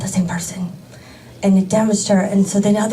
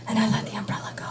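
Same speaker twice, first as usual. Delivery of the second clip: unvoiced, much softer whisper; 10 dB quieter, much faster than usual. Real, not acted. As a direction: hush it for drama. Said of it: "dramatic hush".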